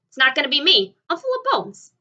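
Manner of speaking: high, loud indignant shout; loud protest. A narrator's reading. Centipede loudly insists he is too bony to eat. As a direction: holler to get out of it.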